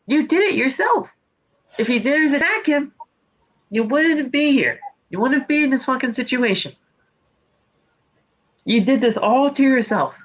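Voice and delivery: high taunting lecture; mock scolding. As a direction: scold him like a naughty kid, pitched high. Lecturing Rollins in put-on voice.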